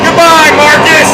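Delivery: high, loud mock send-off; shouted farewell. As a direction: call it out after a departing train. Train leaves, farewell called out.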